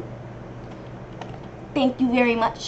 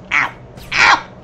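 yelped ow; 6 dB louder than usual. Real, not acted. Gamer getting hit in game, loud yelps of ow. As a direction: cry out at each hit.

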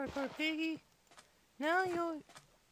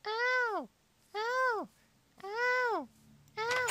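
repeated higher, louder "Ow!"; mock pain cries; 5 semitones higher, 3 dB louder, much slower than usual. Player bumping the door, repeated higher mock ow. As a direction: yelp at each game hit.

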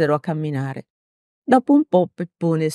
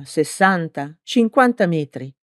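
usual speaking voice

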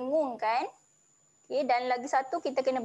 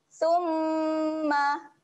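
summa with dengung; 3 semitones higher than usual. Shown as a demonstration. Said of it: "Kita akan dengungkan".

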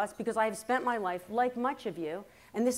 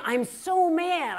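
higher, louder, drawn-out complaint; mock mad mother; 6 semitones higher, 5 dB louder, much slower than usual. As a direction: imitate mother complaining slowly and loudly. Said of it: "she gets mad again".

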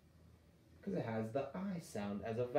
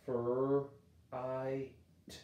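"fur, I, t" stretched slowly apart; sounding out; much slower than usual. Shown as a demonstration. Teacher sounding out fright, slow. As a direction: sound out each part slowly. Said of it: "I G H is gonna make the I sound".

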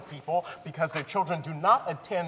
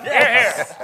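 a loud, laughing yes, pitched up; excited cheer; 6 semitones higher, 11 dB louder than usual. Acted. Community member cheering the boycott's success, laughing and loud. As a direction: cheer it out in triumph.

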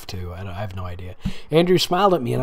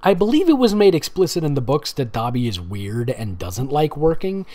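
ordinary delivery

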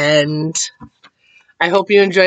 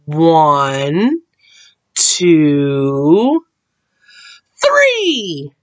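suspenseful count; much slower than usual. A narrator's reading. Mama Frog counting the giant out, drawn-out suspense. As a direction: stretch each number, then pop the last.

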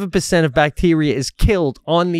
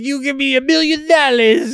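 high-pitched mock demand; exaggerated retold sales pitch; hammed-up Italian pitchman; 12 semitones higher, 6 dB louder than usual. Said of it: "and he's like".

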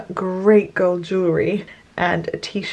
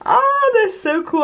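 excited. Real, not acted. Admiring her earrings.

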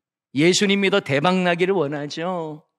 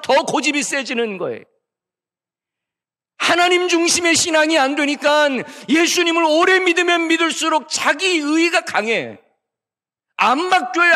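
much higher, louder impassioned preaching; full-force preaching; 10 semitones higher, 6 dB louder than usual. Acted. Pastor exhorting the congregation, high and loud full-force preaching. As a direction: drive the point home to the congregation.

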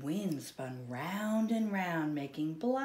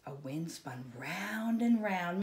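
usual speaking voice

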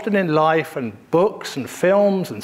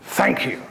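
a hearty, decisive thank you; great big thank you; 3 semitones lower than usual. Shown as a demonstration. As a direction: give thank you a full, emphatic finish. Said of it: "a great big".

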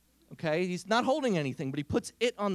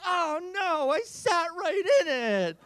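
mock dismay; 10 semitones higher, 5 dB louder than usual. Niece jumps up in dismay.

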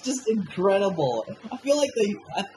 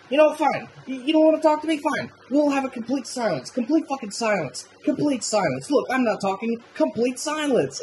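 a lightly voiced Carl, sulky and needling; petulant Carl; 9 semitones higher than usual. Carl nagging his silent partner on the road, higher and huffy. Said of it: "Carl's like".

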